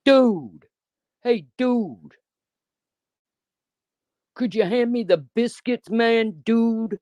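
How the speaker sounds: mocking thug boy at a luncheon. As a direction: ham up a teen thug asking for biscuits. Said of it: "That's what you fucking sound like".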